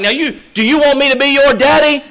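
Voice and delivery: very high, loud shouted question; drill sergeant shout. Retold or imitated. Drill sergeant demands of the boy, loud and high. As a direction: yell it right in the kid's face. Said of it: "He's like".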